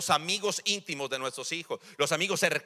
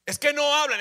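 usual speaking voice

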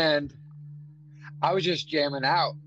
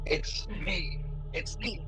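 ghost voice; 3 semitones higher, 6 dB quieter, slower than usual. Retold or imitated. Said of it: "What did it say?".

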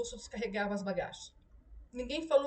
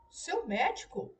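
relieved exclaim; 5 semitones higher, slower than usual. A narrator's reading. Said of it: "exclamou Susana, aliviada".

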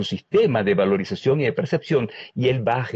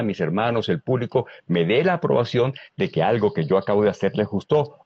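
normal talking voice

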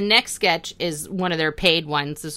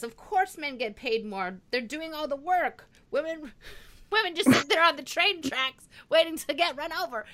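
Debet voice; 8 semitones higher, 3 dB quieter than usual. Retold or imitated. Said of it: "Debet's answer is".

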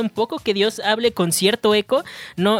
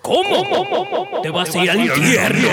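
mock God voice; 7 semitones higher, slower than usual. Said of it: "le decía".

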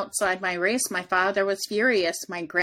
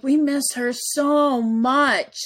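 lightly voiced children, slow and higher; imitating earnest children; 5 semitones higher, slower than usual. Mother retelling her children's answer about grandma, lightly imitated longing. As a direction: draw it out like kids longing for someone.

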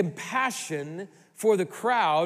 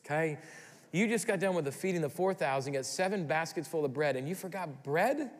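ordinary delivery

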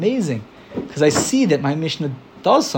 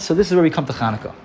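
usual speaking voice

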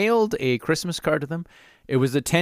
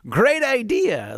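slow, higher, hammed-up cheer; mock enthusiasm; 12 semitones higher, 3 dB louder, much slower than usual. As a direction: sarcastically fake delight. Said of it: "jump up and down and be like".

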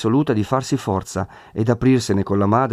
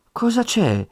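anxious mother's question, much higher; high, worried mother; anxiously; 12 semitones higher, slower than usual. A narrator's reading. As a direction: ask in a worried rush of pitch. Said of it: "domandò di nuovo ansiosamente".

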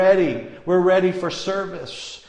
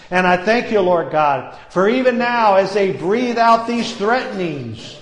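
ordinary delivery

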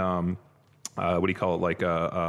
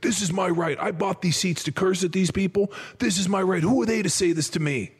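entitled fan ranting; 11 semitones higher than usual. Retold or imitated. Heckler insists to security, indignant rant. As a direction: play the self-righteous heckler demanding his right.

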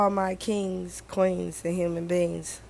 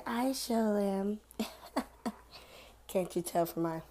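usual speaking voice

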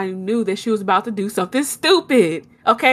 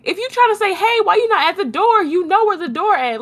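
incredulous; 11 semitones higher than usual. Retold or imitated. Retold scolding of the dog.

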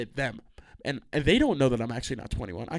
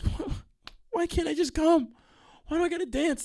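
mock disbelief. Him balking at being asked to dance. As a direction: squeak out the outrage. His incredulous reply retold.

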